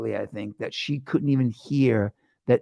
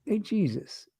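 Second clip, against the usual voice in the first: soft, higher mock Queens accent; a hint of Queens accent, quietly echoed; 6 semitones higher, 6 dB quieter than usual. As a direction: lightly imitate a Queens native calling out. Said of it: "He sounds like he's from Queens".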